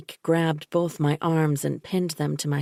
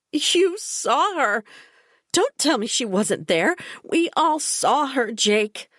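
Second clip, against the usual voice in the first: high, slow, pressing, on the edge; desperate, pitched-up plea; hysterical insistence; 9 semitones higher than usual. A narrator's reading. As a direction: force him to admit it, half hysterical. Hysterical insistence after laughing.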